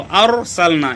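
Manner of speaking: अरसलना slow with a full, heavy r. Shown as a demonstration. Teacher reciting a heavy ra, slowly. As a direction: draw out अरसलना with a thick ra. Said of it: "यहाँ पर भी रा पुर होगी".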